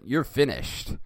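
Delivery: punchy theatrical menace; mock threat. A narrator's reading. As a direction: deliver a hammed-up final threat. Bart threatens Nelson in the story.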